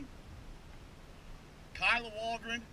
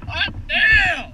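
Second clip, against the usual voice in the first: high, loud cry of cold shock; shocked yelp; 10 semitones higher, 7 dB louder than usual. Real, not acted. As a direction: yelp from the freezing water. Shock after icy water hits.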